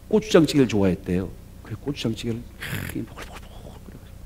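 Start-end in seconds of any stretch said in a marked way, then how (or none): 1.6-4.2 hushed storytelling. Retold or imitated. Wife secretly cooking a surprise.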